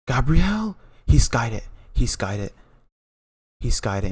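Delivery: loud, high burst of disbelief, easing down; shocked shout. Real, not acted. Fan seeing the last penalty go over, shocked shout. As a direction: cry out in real shock at the miss.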